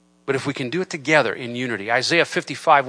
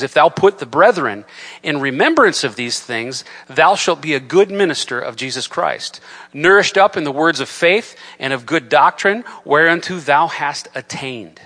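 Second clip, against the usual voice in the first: louder, measured Bible reading with a slowing close; scripture reading; 3 semitones higher, 6 dB louder than usual. Acted. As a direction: read the verse out to the congregation. Reads scripture aloud.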